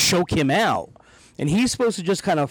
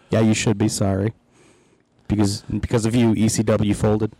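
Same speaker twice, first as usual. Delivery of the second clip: much lower, gruff scolding; mock stern Nova; 6 semitones lower than usual. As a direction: lay the blame on him from deep down. Nova blaming him.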